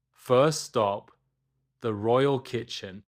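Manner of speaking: usual speaking voice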